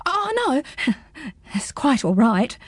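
put-on hurried nervous boy; mild nervous laugh; nervous gulp. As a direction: back away with a gulp. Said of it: "gulped Pins".

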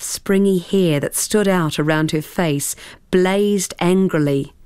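plain reading voice

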